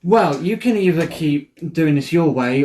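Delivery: ordinary talk, nothing marked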